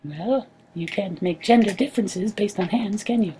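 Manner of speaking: tiny creature voice. Acted.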